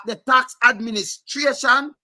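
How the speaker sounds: slow, stress landing on "tax administration"; emphasis on tax administration. Shown as a demonstration. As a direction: lean hard on "tax", drawing the phrase out. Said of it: "Shall put emphasis on it".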